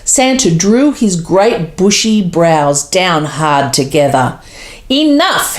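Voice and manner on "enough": a sharp high cry of "Enough"; cried out. A narrator's reading. Santa crying out at his grumbling reindeer. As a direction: Santa snapping at his lazy team. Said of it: "he cried out".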